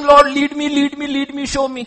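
high, monotone, repeated pleading; insistent mock prayer. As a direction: imitate believers begging on and on in prayer. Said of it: "We are saying".

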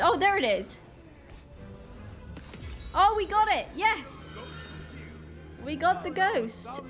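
usual speaking voice

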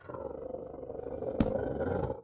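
a drawn-out, airy gasp of shock; long gasp. Real, not acted. Gamer stares at the screen, long shocked gasp. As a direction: catch your breath at the sight.

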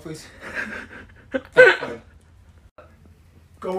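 ordinary talk

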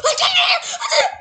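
very high squealing shout; triumphant scream. Real, not acted. Player celebrating that they did it, high triumphant scream. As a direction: shriek it out in triumph.

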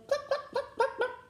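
very high clucks with a laugh; the hens chasing the farmer over the hill. A narrator's reading.

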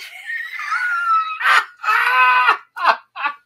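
loud, shrieking high laugh; hysterical laughter. Real, not acted. Host cracks up at the shop's name, hysterical laughter. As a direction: burst out in uncontrollable squealing laughter. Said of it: "made me laugh so hard".

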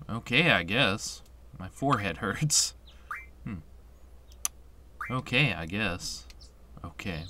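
low, subdued reluctant reply; mumbled, downbeat answer from the hero; sullen game hero. Acted. Voices Squall's replies in the game.

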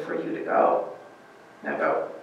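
soft whispered command; a hushed, firm order to leave; Jesus commanding. Retold or imitated. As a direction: dismiss them quietly but firmly. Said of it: "And he says".

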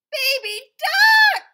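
slow, very high, loud reveal; joyful shout. A narrator's reading. Reading to a child, thrilled shout at the hatching. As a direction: cry it out with delight.

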